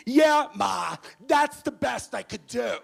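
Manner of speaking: put-on high, shouted teenage whine at mom; bratty high school sophomore. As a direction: yell back at your mother like a sophomore. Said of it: "I act like a high school sophomore".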